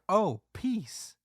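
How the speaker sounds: high, odd mock voice from the crowd; a weird voice in the background; lightly voiced odd bystander.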